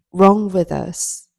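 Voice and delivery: ordinary delivery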